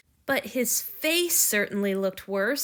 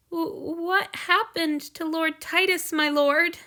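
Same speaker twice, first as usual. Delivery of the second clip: higher, slow, hesitant; fearful stammer; 7 semitones higher, slower than usual. Acted. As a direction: ask dreading the answer. Hanyu fearful question.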